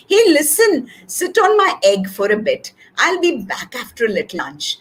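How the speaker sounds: ordinary delivery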